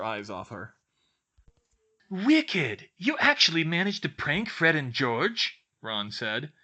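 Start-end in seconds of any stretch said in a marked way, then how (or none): 2.1-5.6 high, loud delighted exclamation; impressed, excited. A narrator's reading. Ron marvels at Hermione's prank, excited.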